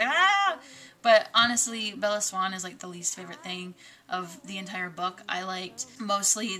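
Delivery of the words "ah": high loud fangirl squeal; freak-out shriek. Pretend. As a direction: squeal like a fan losing it. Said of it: "freak out and be like".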